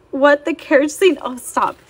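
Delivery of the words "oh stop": ordinary delivery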